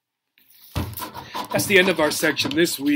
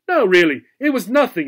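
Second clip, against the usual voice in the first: insisting; 3 semitones higher, 4 dB louder than usual. A narrator's reading. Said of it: "insisted Sugar Smacks".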